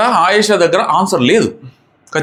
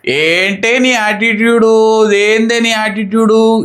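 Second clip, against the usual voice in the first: slow, higher, drawn-out mock taunt; imitating a rude snap; 4 semitones higher, much slower than usual. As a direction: drag out each word like a sneering housemate. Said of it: "ఇంత విసురుగా మాట్లాడుతుంది". (నెమ్మదిగా సాగదీస్తూ వెటకారంగా అనుకరించడం)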